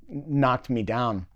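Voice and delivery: usual speaking voice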